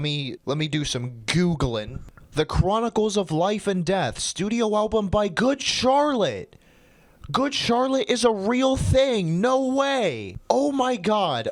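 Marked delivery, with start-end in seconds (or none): 2.3-11.5 much higher, louder amazement; real stunned excitement; astonished discovery. Real, not acted. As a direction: gasp it out in disbelief.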